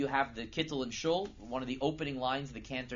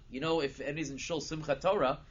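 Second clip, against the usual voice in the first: normal talking voice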